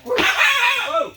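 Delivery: high, loud drawn-out whoa; big mock whoa. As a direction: throw a huge surprised whoa into the air. Big wave, spin in the air.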